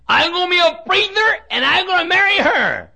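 high loud garbled preacher as preather; hammed-up impediment. Preacher imitating Joe vowing to marry the queen, high mock voice. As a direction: mimic Joe's bold claim, mushy. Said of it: "can't even talk plainly".

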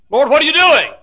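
very high, loud outcry to God; desperate cry. Acted. Preacher challenging the Lord over suffering, high desperate shout. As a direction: wail the question at heaven.

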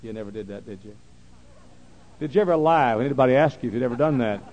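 usual speaking voice

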